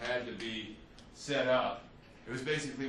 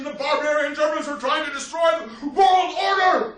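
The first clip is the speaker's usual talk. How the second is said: war-fever shout; 11 semitones higher, 11 dB louder than usual. Retold or imitated. Teacher voicing British crowds' war fever, building, much louder, higher shout. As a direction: roar it like an outraged British crowd.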